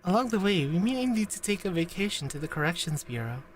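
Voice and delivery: low, soft, earnest character voice; low quiet game dialogue read; reading Sensei's lines. Acted. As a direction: voice the gentle teacher.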